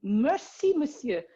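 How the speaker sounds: high-voiced French pleasantries. Shown as a demonstration. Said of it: "I can switch".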